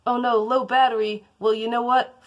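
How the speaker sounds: high, loud annoyed outburst at phone; talking to my phone. Real, not acted. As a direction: snap at the dying phone. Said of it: "that was me talking to my phone".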